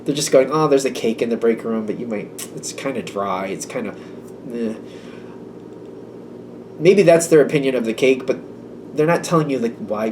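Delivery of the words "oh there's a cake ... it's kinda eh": lower, much softer, shrugging downplay; dismissive aside. Retold or imitated. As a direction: make it sound unappealing, quietly. Coworker badmouthing the cake.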